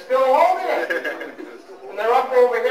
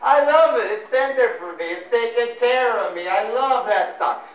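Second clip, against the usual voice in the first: lovestruck stockholder; 5 dB louder than usual. Retold or imitated. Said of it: "They talk like that all the time".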